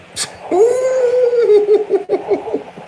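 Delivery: burst of laughter. Real, not acted. Preacher cracking up at his own reading, high laugh.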